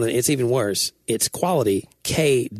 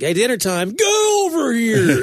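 high, drawn-out mock shout; calling the kids; 9 semitones higher, 4 dB louder, slower than usual. Joking a game villain calls his kids to dinner, long shout. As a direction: holler the catchphrase long and loud. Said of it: "when he calls his kids".

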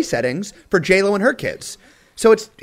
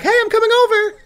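put-on girl's voice; faster than usual. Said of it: "she's like".

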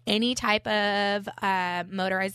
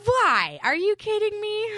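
incredulous; 4 dB louder than usual. Real, not acted. Mock outrage at truck called Yoda.

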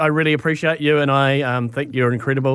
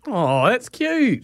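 drawn-out, higher coo; touched cooing; 7 semitones higher, much slower than usual. Real, not acted. Radio host reacting to a sweet father-child call, cooing. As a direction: melt over something cute, stretching it out.

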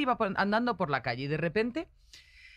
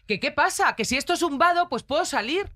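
higher, louder mock rant of an irate driver; retold driver's raised-voice rant; angry driver; 9 semitones higher, 4 dB louder than usual. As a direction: play the indignant driver shouting out his window.

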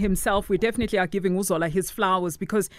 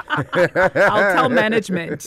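laughing; 5 dB louder, much slower than usual. Real, not acted.

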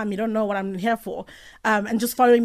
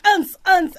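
imitated club beat; 10 semitones higher, 7 dB louder than usual. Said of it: "Yo, I was like".

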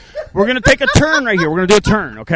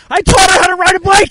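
proud excited shout; 6 semitones higher, 5 dB louder than usual. Real, not acted. Proud boast after her success.

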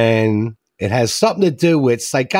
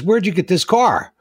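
voiced higher as the mother; a mildly shocked mother asking; mother's question; 8 semitones higher than usual. Joke teller voicing the surprised mother. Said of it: "The mother goes".

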